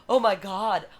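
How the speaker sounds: gasp, then loud amazed exclamation; mock stunned awe; awestruck prince imitation. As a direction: mimic a smitten prince catching his breath. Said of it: "the prince is like".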